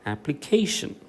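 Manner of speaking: Application with 'KAY' lifted; third-syllable stress. Shown as a demonstration. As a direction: raise the 'ക്കേ' in application. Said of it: "കെ എന്ന് പറയുന്നത് നമ്മൾ കുറച്ച് ഉയർത്തി". ('Application', 'ക്കേ' ഉയർത്തി ഊന്നി)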